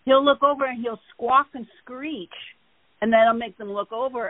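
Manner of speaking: normal talking voice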